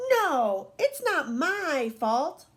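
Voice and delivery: put-on stretched, indignant little-boy denial; David's excuse. A narrator's reading. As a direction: protest like a child in trouble. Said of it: "he always says".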